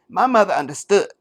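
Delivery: a hammed-up Harris who swallows before speaking; mock Harris with a swallow. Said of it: "And she'd do a little swallow first".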